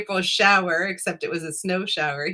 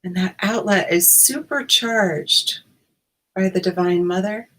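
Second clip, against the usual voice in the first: guided meditation. Acted. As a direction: ease listeners in, unhurried.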